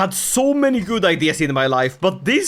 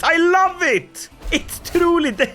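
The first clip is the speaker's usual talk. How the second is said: delighted; 7 semitones higher than usual. Real, not acted. Overjoyed at his kingdom.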